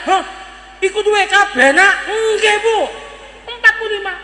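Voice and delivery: high, astonished back-and-forth; amazed excitement. Acted. Mother and son marvel at the salary, excited.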